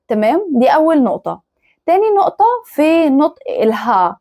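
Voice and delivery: ordinary talk, nothing marked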